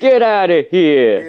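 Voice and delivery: slow, drawn-out New York accent. Shown as a demonstration. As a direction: stretch it out like a New Yorker. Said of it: "tell when someone's from New York".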